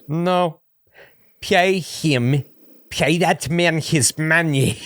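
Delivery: bad movie Russian mobster line; mock Russian accent, slow and high; terrible Russian accent. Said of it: "a terrible Russian accent".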